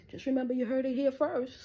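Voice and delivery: real high, loud proclamation; loud warning. Real, not acted. Warning the cops they'll all be fired, loud and emphatic. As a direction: announce it forcefully, pitch held high throughout.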